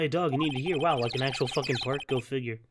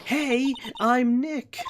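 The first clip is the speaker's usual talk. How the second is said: high, very drawn-out call; louder camera test; 8 semitones higher, much slower than usual. Acted. Nick retries the camera, high stretched-out call. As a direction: slowly call it out high for the microphone. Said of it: "Maybe I'm not saying loud enough".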